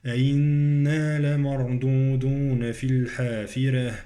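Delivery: held nasal "أئنا", thin r in "الحافرة"; ghunnah, light ra. Shown as a demonstration. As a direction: recite steadily, keep r light in "الحافرة". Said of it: "راء مرققة في كلمة الحافرة".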